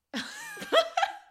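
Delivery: a sudden squealing burst of laughter; high laugh. Real, not acted. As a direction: break into a high shriek of a laugh. Laughs at the ogre description.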